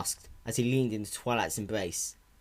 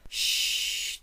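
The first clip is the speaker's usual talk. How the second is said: shushing. Acted. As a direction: hush him gently. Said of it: "Twilight said".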